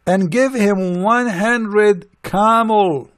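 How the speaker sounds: slow, drawn-out decree, voiced apart from his own; mock Prophet's command. Mocking the Prophet ordering Bilal to pay, slow decree. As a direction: lay it down like a lordly order, slowly. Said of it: "he said".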